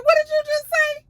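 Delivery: high, loud outraged shriek. Retold or imitated. Diane confronting the man insulting her father, outraged shriek.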